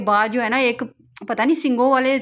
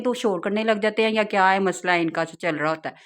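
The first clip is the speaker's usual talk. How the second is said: normal talking voice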